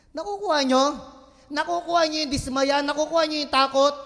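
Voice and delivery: loud, driving preacher's call; preaching at full force. Acted. Pastor pressing the congregation with a loud call. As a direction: press the point home to the congregation.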